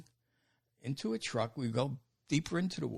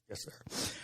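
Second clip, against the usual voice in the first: hushed, almost breathless yes sir; meek yes sir; 9 dB quieter than usual. Retold or imitated. New recruit to the drill instructor, meek and hushed. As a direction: answer the drill instructor meekly, barely voiced.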